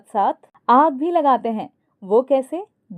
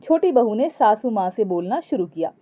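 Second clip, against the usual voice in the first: usual speaking voice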